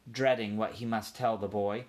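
ordinary delivery

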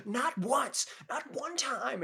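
high, indignant mock yell; imitating Cage's outburst. Vlogger echoing Nicolas Cage's indignant yell, high and hammed-up. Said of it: "repeating Cage's outburst".

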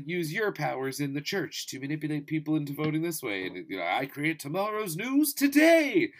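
high, hammed-up megalomaniac; mock villain speech building to a loud, high finish; pure evil villain. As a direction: command world leaders like a gloating villain. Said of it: "pure evil".

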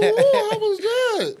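high, loud, stretched-out laughing question; excited laughing question. Real, not acted. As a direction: laugh it out in amazement. Amazed host reacts, laughing.

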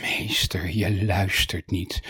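slow, low, breathy exasperation; hushed, drawn-out, weary reproach; deep sighing. A narrator's reading. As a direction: sigh the words out from your toes. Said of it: "de zucht uit zijn tenen kwam".

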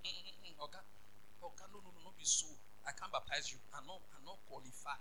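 very soft, low acted reluctance; hushed reluctant refusal. John refusing to baptize Jesus, hushed and reluctant. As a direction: back away in a tiny, low voice.

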